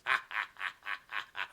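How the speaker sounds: low, measured evil chuckle; spaced-out hammed-up villain ha-ha; villain's mock laugh. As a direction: gloat over a helpless victim. Villain laughs at his victim.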